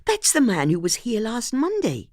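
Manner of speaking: high, excited realization; surprised. A narrator's reading. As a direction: gasp it out, still surprised. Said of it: "Antonia said in surprise".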